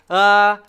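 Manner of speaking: loud, held "uh"; "uh" as pure voicing, sustained; adding voice. Shown as a demonstration. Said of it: "But I'm adding my voice".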